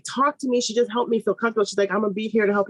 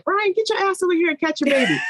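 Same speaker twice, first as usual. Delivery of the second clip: retold shout; 11 semitones higher than usual. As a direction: order the husband over to catch the baby. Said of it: "she said".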